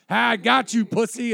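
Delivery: very high, loud taunt; gloating dad. Acted. Dad revealing the prank to his son, gloating yell. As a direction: crow over a prank victim, mocking.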